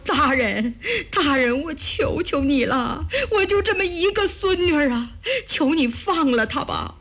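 put-on old granny begging for mercy; pleading old woman. A narrator's reading. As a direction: plead desperately as a frail grandmother. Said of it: "一个白发苍苍的老婆婆连滚带爬".

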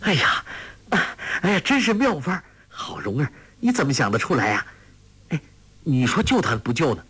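high, bubbling with delight; laughing delight. A narrator's reading. Guo Jing praising Rong'er's clever reed trick, delighted and high. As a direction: clap and cheer at her clever trick. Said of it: "郭靖拍手笑道".

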